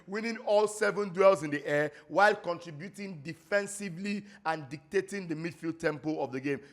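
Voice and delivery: ordinary delivery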